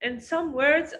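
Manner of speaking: usual speaking voice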